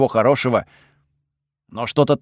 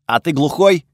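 snide; 2 semitones higher, 3 dB louder than usual. A narrator's reading. Готфред needling the narrator over his obvious question, snide. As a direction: needle him with a mock question. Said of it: "съязвил Готфред".

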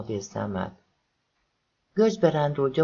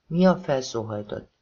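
ordinary delivery

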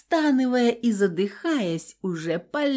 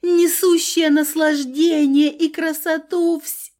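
outraged haughty goddess; 7 semitones higher than usual. A narrator's reading. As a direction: lord it over a mere mortal.

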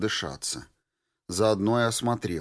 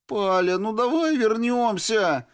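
high, whiny plea; whining plea; 13 semitones higher, 4 dB louder than usual. A narrator's reading. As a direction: whine it like a scared recruit. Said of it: "почти проскулил он".

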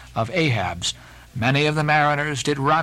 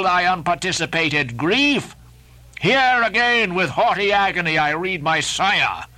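raised, impassioned captain's oration; Ahab, defiant captain; 2 semitones higher than usual. Acted. Ahab defying the fire-spirit, impassioned and exalted. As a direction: hurl the words at the flames in proud defiance. Ahab's long speech to fire.

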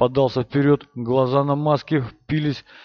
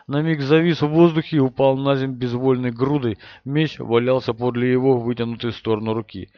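ordinary delivery, nothing marked

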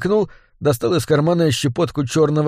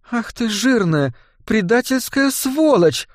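high, slow, fervent insult; heartfelt nun; 9 semitones higher, slower than usual. A narrator's reading. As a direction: pour feeling into the curse. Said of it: "проникновенно начала монахиня".

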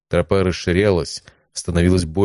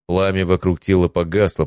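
ordinary delivery, nothing marked

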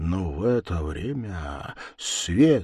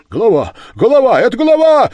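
very high, loud rising alarm cry; alarmed shouting; 14 dB louder, faster than usual. Acted. The lads recognise the head and shout in alarm. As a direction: scream it as you scatter. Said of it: "закричали хлопцы".

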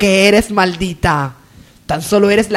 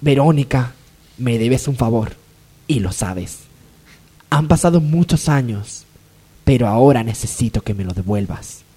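sinister low voice; 10 semitones lower than usual. Acted. Adriana tells the maid she owes her a favour, low and sinister. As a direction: sink the voice like a villain plotting.

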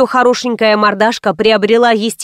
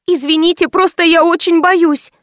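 put-on higher-pitched, timid girl's voice; scared apology; 7 semitones higher than usual. A narrator's reading. Анжела admitting fear to the visitor, high and timid. Girl admits she is afraid.

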